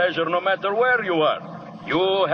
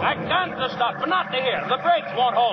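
higher, fast yell over the engine; shouting back; 10 semitones higher than usual. Acted. Driver yelling back at the wounded men, high and hurried.

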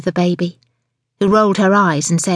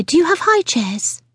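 higher-pitched, brisk polite question; polite customer request; 10 semitones higher than usual. Acted. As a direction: ask politely in a high customer voice. First take, polite request.

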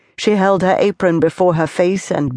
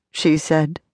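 ordinary delivery, nothing marked

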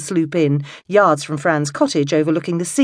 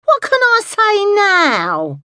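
very high, slow, plaintive wail; drawn-out high plea; 5 dB louder, much slower than usual. A narrator's reading. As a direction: wail it out like a let-down old lady. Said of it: "wailed Alice".

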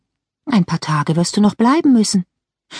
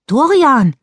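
high, loud startled call of a name; startled gasp of a name; startled cry; 9 semitones higher, 5 dB louder than usual. Acted. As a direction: cry out his name in shock. Turns, sees ghost brother.

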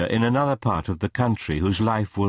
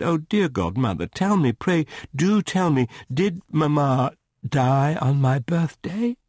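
higher-pitched, acted child's plea, slowing at the end; tearful pleading; 6 semitones higher than usual. A narrator's reading. Esther begging her godmother about mamma, mildly tearful pleading. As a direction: beg for an answer, a touch tearful. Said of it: "I broke out crying and sobbing".

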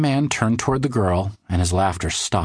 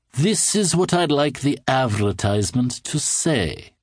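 slow, higher, strange unsettling voice; creepy odd man; 6 semitones higher, slower than usual. A narrator's reading. Odd man asking the cartoonist to place an ad, creepy and smug. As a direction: speak with a fixed unsettling smile.

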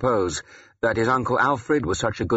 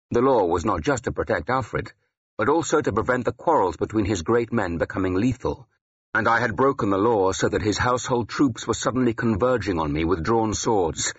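ordinary delivery, nothing marked

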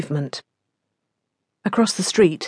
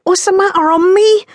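high, louder quavering question; a cornered man warbling in fright; 14 semitones higher, 6 dB louder than usual. Acted. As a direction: voice climbing with fear. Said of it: "he warbled".

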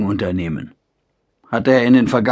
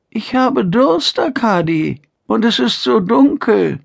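put-on frail old mother; 11 semitones higher than usual. Old mother asks for water.

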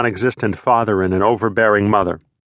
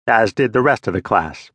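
ordinary delivery, nothing marked

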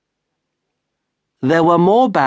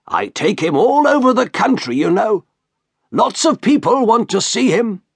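higher, eager showman's voice; boastful shopkeeper; 9 semitones higher than usual. Acted. Shopkeeper boasting to the doctor about Merrick, higher eager showman. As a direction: sell the freak show to the gentleman.

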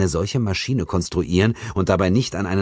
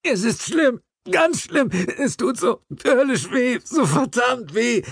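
high, strained voice of the injured man, slowing at the end; moaning in pain. A narrator's reading. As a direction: groan out the pain, dragging the last words.

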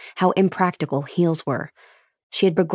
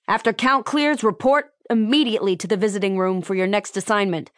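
barked orders; 5 semitones higher than usual. Acted. Lopez continues barking orders.

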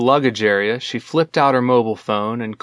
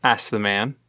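ordinary delivery

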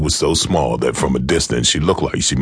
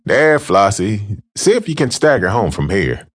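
hurried gentleman; 9 semitones higher than usual. Acted. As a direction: brush her off, then rush to leave. Gentleman dismisses drunk woman.

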